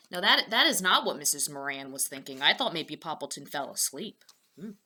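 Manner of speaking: ordinary delivery, nothing marked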